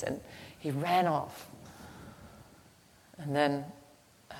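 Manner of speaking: ordinary delivery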